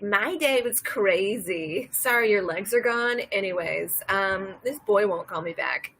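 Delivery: loud, drawn-out mock complaint; breezy, loud gossip to a patient; self-absorbed nurse. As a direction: play an oblivious nurse oversharing at full volume.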